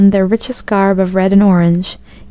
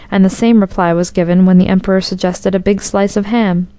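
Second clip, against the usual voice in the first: plain reading voice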